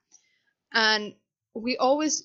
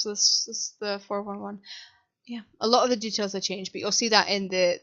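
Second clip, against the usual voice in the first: ordinary talk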